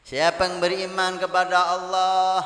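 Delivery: loud, high, sustained sermon delivery; forceful preaching. Real, not acted. Preacher translating the hadith for the congregation, forceful proclamation. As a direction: proclaim it to the whole congregation, drawing out the endings.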